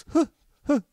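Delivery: two short startled huffs; imitated startled waking. Roommate wakes when his hand is held, startled huffs. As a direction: huff as if jolted out of sleep. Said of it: "he's like".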